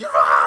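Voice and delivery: short panicked shout; panicked yell. Retold or imitated. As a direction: let out a panicked cry. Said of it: "so I just start freaking out".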